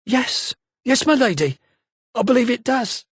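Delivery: a lightly voiced girl lighting up with hope; excited exclaim. A narrator's reading. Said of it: "she exclaimed".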